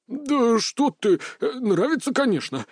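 put-on hasty nervous old man voice; flustered old man. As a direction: backpedal in a hurry. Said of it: "поспешно".

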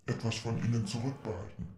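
ordinary talk

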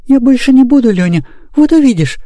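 put-on high, earnest girl's promise; fervent. A narrator's reading. Girl promising brother to behave, fervent high voice. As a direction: promise eagerly as a little girl. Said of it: "горячо воскликнула Мурка".

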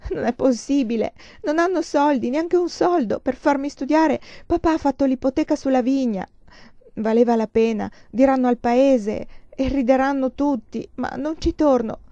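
acted anguished outburst; tearful despair. A narrator's reading. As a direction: pour out the hopelessness, close to crying. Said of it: "E ricominciava a piangere".

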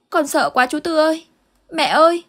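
put-on a scared kid breaking down; terrified child. Boy pleading with Uncle Tư and his mother, terrified near-crying voice. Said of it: "thái độ hết sức hoảng loạn".

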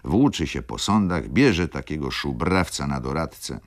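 ordinary delivery

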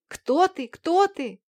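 high, scared shout; frightened cry. A narrator's reading. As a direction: cry out in terror. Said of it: "закричал Аладдин".

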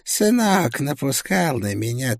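eerie mocking spirit voice; ghostly voice. A narrator's reading. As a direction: taunt like a dead soul. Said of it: "сказала душа". (потусторонний насмешливый голос)

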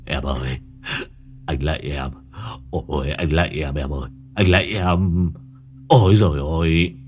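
low, moaning, begging in a nightmare; terrified plea. A narrator's reading. Husband begging the ghost in his sleep, moaning in terror. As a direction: grovel before the ghost, moaning. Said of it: "Long rú lên một tiếng".

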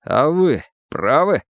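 slow, pointed challenge; hint of anger. A narrator's reading. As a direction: ask directly with restrained anger. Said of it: "в голосе его звякнула злость". (сдержанная злость)

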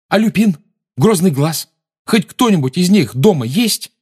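high, loud urgent questions. Acted. Harry desperate questions.

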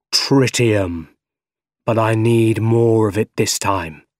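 drawn-out villain voice; Doc Ock, demanding. A narrator's reading. Doc Ock demanding tritium from Harry, menacing.

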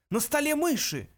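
startled high-pitched yell; high, startled outburst; sudden yell. A narrator's reading. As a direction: cry out in sudden alarm. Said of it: "внезапно завопил".

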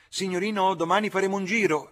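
high, loud, quick street call. A narrator's reading. Coachman offers the young gentleman a ride, calling out. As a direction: coachman hailing a customer up at the window.

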